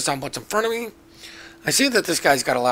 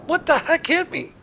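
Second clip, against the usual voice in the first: shocked surprise; 13 semitones higher than usual. Retold or imitated. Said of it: "I was like".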